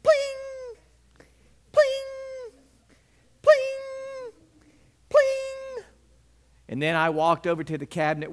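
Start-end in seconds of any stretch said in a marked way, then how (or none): 0.0-5.8 high-pitched plate clanks, one by one; mock plate clatter. Boy slams plates to show displeasure, high clanking noises. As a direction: bang each plate down, voiced high.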